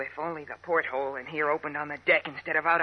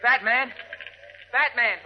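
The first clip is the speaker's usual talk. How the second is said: calling out; 4 semitones higher, 3 dB louder, slower than usual. Acted. Calling for missing friend.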